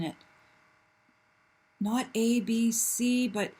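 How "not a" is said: ordinary delivery, nothing marked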